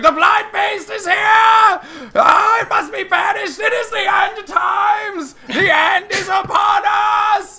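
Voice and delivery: shouting, high-pitched end-times prophet; raving doomsayer. Acted. Street prophet warning all who will listen, raving. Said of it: "you know, raving".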